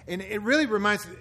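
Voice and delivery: normal talking voice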